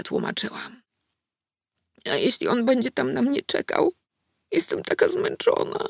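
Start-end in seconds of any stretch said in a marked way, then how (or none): 2.0-5.8 higher, frightened little voice; a scared child on the edge of tears; trembling voice. A narrator's reading. Exhausted girl asks about the demon, trembling voice. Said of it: "powiedziała drżącym głosem".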